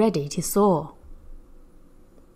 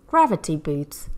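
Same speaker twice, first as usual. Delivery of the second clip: ordinary delivery, nothing marked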